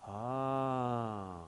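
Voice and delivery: long, soft falling "ahh"; long resigned sigh. Retold or imitated. Climber stuck on the platform weighing her choice, long sigh. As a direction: let out a drawn-out, quiet sigh. Climber pauses before giving in.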